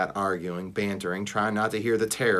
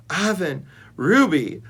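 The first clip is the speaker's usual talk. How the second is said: higher, louder happy calls; delighted calling; 7 semitones higher, 5 dB louder than usual. A narrator's reading. Snickers calling to her rescuers, delighted. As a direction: greet old friends with joy. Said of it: "calls in a delighted voice".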